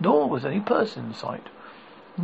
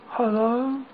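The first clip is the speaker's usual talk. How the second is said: put-on boy voice; 8 semitones higher, 3 dB louder than usual. A narrator's reading. Boy character greets Dorothy.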